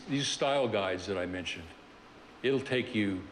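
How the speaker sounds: usual speaking voice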